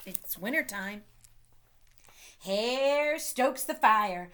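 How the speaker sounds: ordinary delivery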